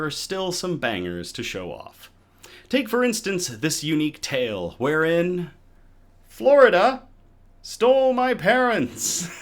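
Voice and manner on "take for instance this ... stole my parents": excited. Acted. Showing off a fun beer name.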